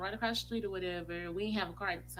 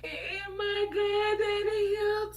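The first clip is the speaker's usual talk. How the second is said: high, wailing cry, drawn out; 14 semitones higher, 8 dB louder, much slower than usual. Retold or imitated. Daughter seeing her family's truck, breaking into high wailing sobs. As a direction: sob it out in panic. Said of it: "I bust out crying and said".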